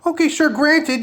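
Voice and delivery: high, whiny mock denial; sarcastic high-voiced parody of a nervous streamer; mocking impression. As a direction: pitch it up like a scared man pretending he's fine.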